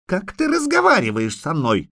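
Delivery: put-on high-pitched haughty child; indignant little princess. A narrator's reading. Little princess rebukes the witcher, indignant and high. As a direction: pipe up in offended dignity.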